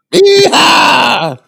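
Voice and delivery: high, loud whooping yeehaw; cowboy yell. Acted. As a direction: whoop like a rodeo rider. Cowboy cry riding bomb.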